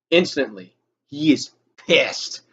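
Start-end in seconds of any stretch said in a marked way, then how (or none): none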